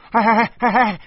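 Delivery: high, loud, fast calling after someone; frantic shouting. A narrator's reading. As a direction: shout urgently to stop them. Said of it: "我急了，我说".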